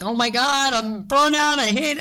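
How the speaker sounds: high-pitched dramatic lament; hammed-up burnt-out wail; mock despair. Acts out her plea for help.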